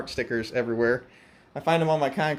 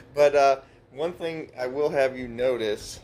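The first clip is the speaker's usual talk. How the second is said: normal talking voice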